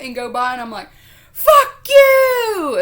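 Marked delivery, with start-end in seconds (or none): 1.3-2.8 high, very loud screech; full-volume road-rage yell; retold angry scream. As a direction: reenact cursing out a passing driver. Retold yelling back at passing drivers.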